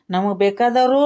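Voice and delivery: ordinary delivery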